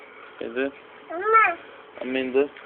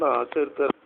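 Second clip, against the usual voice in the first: usual speaking voice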